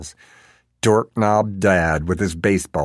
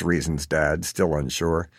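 ordinary delivery

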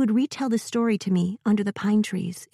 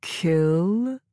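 slow robot, rising unsure; a machine doubting its own order; uncertain robot voice. Acted. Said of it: "though it sounded uncertain".

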